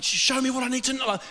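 high, quick cry at everyday volume; retold screaming plea. Preacher recalling his demand to God, retold screaming plea. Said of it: "I'm screaming at God".

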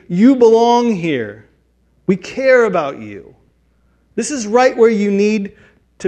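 high, loud and slow: you belong here; loud reassurance. Pretend. Church member assuring a newcomer they belong, ardent. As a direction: reassure them warmly and fully.

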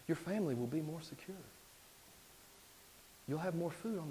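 hushed, coaxing tempter's voice; Satan the tempter. Acted. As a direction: tempt him softly, with long pauses. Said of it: "And Satan's lying to him".

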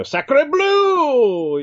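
high, hammed-up French accent; French guy voice. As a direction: exclaim like a cartoon Frenchman. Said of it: "the French guy is going off in his own language".

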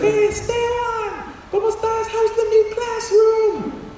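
very high, drawn-out excited friend voice; hammed-up squeaky greeting to a long-lost pal; Camilo. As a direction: call it out slowly, sky-high. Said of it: "He's like".

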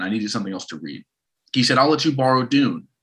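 ordinary talk

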